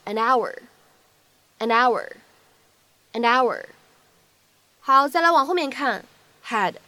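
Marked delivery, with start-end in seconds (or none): 0.1-3.7 "an hour" with N carried over, a-nour; linking. Shown as a demonstration. Teacher showing linking of and our. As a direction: link "an hour" to sound like a-nour. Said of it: "我们呢可以读成an hour".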